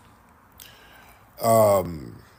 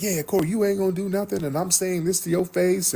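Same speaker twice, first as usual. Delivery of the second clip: higher, fast mock bravado; imitating Anton; 9 semitones higher than usual. Mocking Anton's claim he'd fight Corey, fast mock bravado. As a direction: put on a cocky voice. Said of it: "Anton was like".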